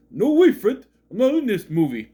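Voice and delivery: high, loud cartoon sidekick with giggles; high giggly buddy voice, laughing before and after; Barney. Sidekick turning Fred down, high giggling cartoon voice.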